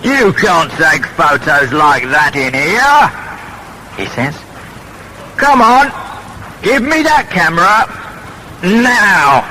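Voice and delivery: angry guard. A narrator's reading. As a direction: demand the camera back, firmly. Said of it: "and he is very angry".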